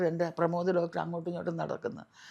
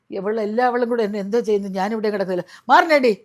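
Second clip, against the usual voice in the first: angry shout; 3 semitones higher, 12 dB louder than usual. Retold or imitated. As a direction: drive them all off. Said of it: "എനിക്ക് ദേഷ്യം കൂടി".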